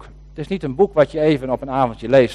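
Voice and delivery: normal talking voice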